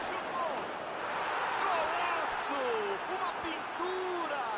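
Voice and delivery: goal call, high and rising to a shout; explosive goal commentary. Real, not acted. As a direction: scream the goal like a TV narrator. (narração de gol explosiva)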